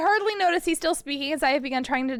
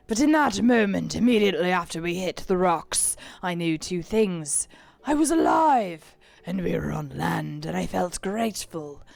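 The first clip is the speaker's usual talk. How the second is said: pirate captain voice; 4 semitones lower, 4 dB louder, slower than usual. Acted. Reads pirate log in voice.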